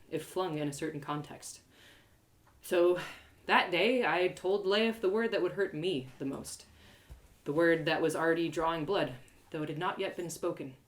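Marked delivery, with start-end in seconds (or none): none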